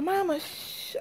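soft 'Mama' trailing into a shush; small hushed child voice, imitated; imitating child's hushing. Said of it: "Y'all know how she be hushing me".

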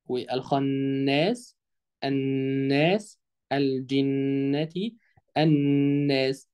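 very slow, with each doubled ن held as a hum in والخناس, الناس, الجنة; ghunna. Shown as a demonstration. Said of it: "هتبقى موجودة فين".

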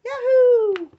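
high, building to a cheer; excited kitty. Acted. Freed kitty spirit cheering, excited. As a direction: squeal with joy as you float. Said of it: "Kitty Cat said".

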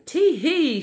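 high giggling tee hee; a cheeky granny chortle; playful little titter. A narrator's reading. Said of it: "she chortled".

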